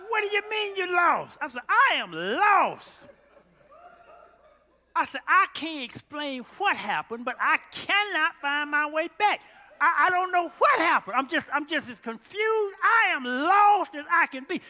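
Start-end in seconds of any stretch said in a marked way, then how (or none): 0.1-1.4 high, loud disbelieving question; incredulous friend. Retold or imitated. 1.7-3.1 slow, loud, deliberate words; emphatic admission. Retold or imitated. Retold answer to friend. 5.3-14.6 loud, high, laughing bewilderment; laughing confusion. Retold or imitated. Retold call, laughing at himself.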